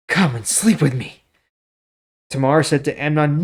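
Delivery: measured storytelling read of scripture; story reading. Acted. Teacher reading the Bible story to viewers, steady narration. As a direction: read the passage like a story to listeners.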